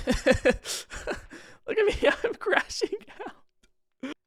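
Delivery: breathless high laughter through the words; laughing hard. Real, not acted. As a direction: crack up at yourself. Laughing at his own footage.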